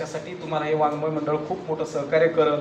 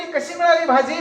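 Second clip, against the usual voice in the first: much higher wife calling out; wife's call, high; 10 semitones higher than usual. Acted. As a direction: call out from inside the house, high. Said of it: "बायको ओरडली". (बायकोची उंच हाक)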